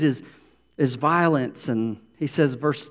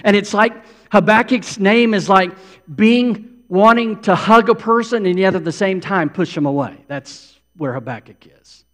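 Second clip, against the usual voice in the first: ordinary talk, nothing marked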